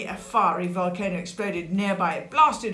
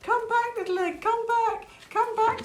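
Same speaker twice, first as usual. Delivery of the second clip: very high, pleading call; acted desperate calling, pitched way up; crying out. A narrator's reading. Sid crying after his lost egg, high pleading call. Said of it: "Sid cried".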